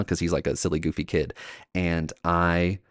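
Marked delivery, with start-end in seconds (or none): none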